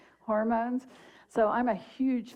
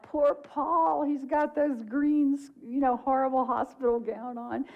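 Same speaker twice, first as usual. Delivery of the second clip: higher, playful mock sympathy; amused mock pity; 5 semitones higher than usual. Widow and friends joke about Paul's hospital gown, amused mock pity. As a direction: pity him teasingly, on the edge of laughter. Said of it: "that moment of laughter".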